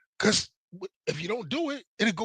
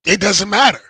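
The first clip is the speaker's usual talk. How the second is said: dismissive; 2 semitones higher, 8 dB louder than usual. Retold or imitated. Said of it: "That's what I'm talking about".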